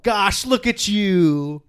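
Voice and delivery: gee-golly small-town neighbor; aw shucks act. Retold or imitated. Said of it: "everybody's just like".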